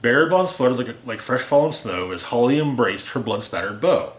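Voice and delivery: ordinary delivery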